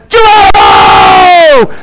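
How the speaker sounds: high, loud, long-drawn Geronimo; gleeful yell. Retold or imitated. The crazy guy leaps onto the zip line, yelling Geronimo. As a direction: launch yourself off the tower yelling. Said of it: "looks at the zip line and says".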